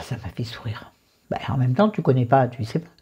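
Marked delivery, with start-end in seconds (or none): 0.0-0.9 a soft, voiceless murmur; whispered aside. Real, not acted. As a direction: say it as a quiet aside to yourself. Aside under breath. (chuchoté en aparté)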